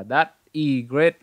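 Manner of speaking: slow "That is great", broken between the words; words wrongly separated. Shown as a demonstration. Teacher shows another take to avoid, slow and unlinked. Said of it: "cũng không phải là".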